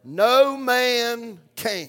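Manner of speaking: drawn out very slowly, each word weighted; slow emphatic recitation. Shown as a demonstration. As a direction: spell it out slowly for the congregation to repeat. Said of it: "I want to say it again".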